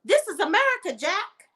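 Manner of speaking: sassy high "This is America, Jack"; sassy put-down. Retold or imitated. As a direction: fling it at him high and cheeky. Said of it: "Girl, I would have been like".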